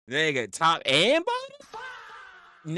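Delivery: high, slow, drawn-out disbelief; shocked disbelief. Real, not acted. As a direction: stretch it out in total shock. Baffled by chat's question.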